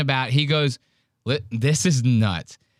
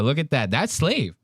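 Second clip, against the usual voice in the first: ordinary talk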